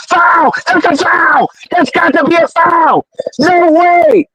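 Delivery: high, heated cries of foul; outraged protest. Real, not acted. Fan watching the stream protests the referee's call with heated cries. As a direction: yell at the referee through the screen.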